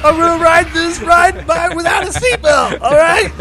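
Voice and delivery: screaming through tears, very high and loud; defiant crying yell. Retold or imitated. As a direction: yell it at your dad, half sobbing. Yelling at dad on ride.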